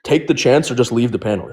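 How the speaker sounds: usual speaking voice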